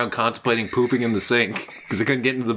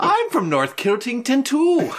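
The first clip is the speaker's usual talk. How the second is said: slow, high mock Scottish line with a laugh; Scottish accent; 10 semitones higher, slower than usual. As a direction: ham up a Scottish Simpsons quote. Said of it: "Simpsons reference".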